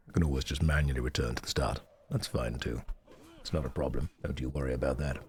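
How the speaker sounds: very deep, reassuring rumble. Pretend. Drops into very deep voice.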